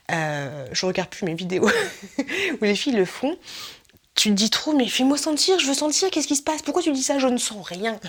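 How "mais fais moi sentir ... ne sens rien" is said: high-pitched, impatient pleading; mock frustrated viewer. Viewer begging to smell the vlogger's product, mock frustration. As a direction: demand it in a raised, exasperated mock voice.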